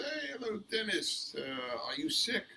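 high, lightly British roommate voice. Retold or imitated. Dennis imitates his British flatmate finding him in bed. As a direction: mimic the flatmate's surprised hello. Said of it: "he looks at me and he goes".

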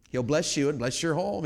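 ordinary talk

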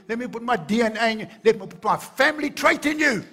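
preacher climbing to a loud, fervent climax; building preacher shout. Acted. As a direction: build from a firm claim to an all-out cry of love. Sermon climax, God's words.

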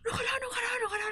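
high, tearful, frantic pleading; panicked crying apology. Retold or imitated. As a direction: beg for forgiveness in terror. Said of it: "เพื่อนก็เหมือนสติหลุด".

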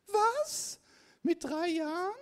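high, squeaky disbelieving "Was?"; incredulous mock gasp of a question; mock astonishment. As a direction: play the shocked listener, way up high. Mock listener astonished at claim.

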